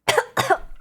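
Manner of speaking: harsh choking cough; coughing fit. Real, not acted. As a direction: cough hard on swallowed water. Choking on some water.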